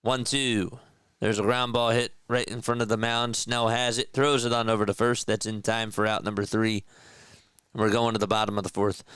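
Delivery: play-by-play call. Acted. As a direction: call the count like a TV broadcaster. Live play-by-play of his game.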